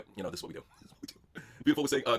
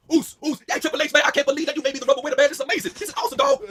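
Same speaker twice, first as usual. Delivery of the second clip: higher, louder hyped-up wrestler voice; excited Jey Uso; 8 semitones higher, 5 dB louder than usual. Acted. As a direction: yell it like a thrilled champ. Jey Uso voiced thanking Triple H.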